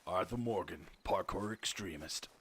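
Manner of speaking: a lightly voiced drawling outlaw; mock-serious cowboy announcer; Arthur Morgan impression. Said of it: "I wish I could do an Arthur Morgan accent".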